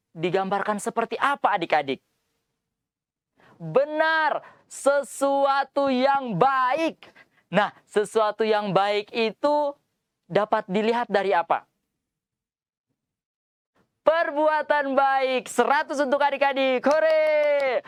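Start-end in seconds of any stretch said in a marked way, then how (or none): 3.6-7.1 high, slow cheerful praise; excited praise. Acted. Praising children's answer. 14.0-17.8 excited cheer. Acted. Cheering children's answer.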